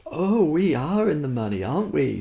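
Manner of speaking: sugary delighted collector; smiling sigh. A narrator's reading. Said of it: "with the long smiling sigh".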